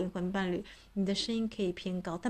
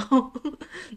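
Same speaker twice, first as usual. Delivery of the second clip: high loud laugh; real amused laugh; burst of laughter; 7 semitones higher, 8 dB louder than usual. Real, not acted. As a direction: laugh out at the surprise. Laughing at the cat.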